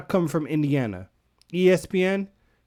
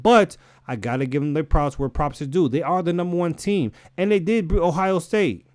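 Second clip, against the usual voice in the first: ordinary talk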